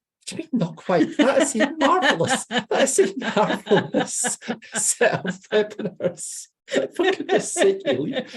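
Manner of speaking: high, laughing indignation; mock outrage. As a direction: squeal it out, laughing in disbelief.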